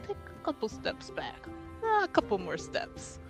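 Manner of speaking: soft, under-the-breath instruction; hushed aside to guards. Pretend. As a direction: murmur it discreetly, as if waving someone back. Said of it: "we can just be like".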